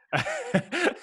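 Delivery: sudden high laugh at her own slip; burst of laughter. Real, not acted. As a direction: laugh out loud, caught off guard.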